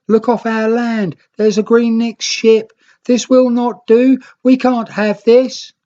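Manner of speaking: high, loud alarmed cry; alarmed islanders. Acted. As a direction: raise the alarm at a strange ship. Rednicks alarmed at ship.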